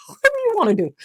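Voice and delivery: very high, sing-song pushover; hammed-up high people-pleaser; eager to please. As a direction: float it up high and sweet. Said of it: "I'm like".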